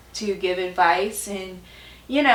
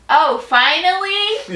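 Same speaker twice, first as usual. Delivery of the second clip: amused surprise; 8 semitones higher, 7 dB louder, slower than usual. Retold or imitated. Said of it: "she's like".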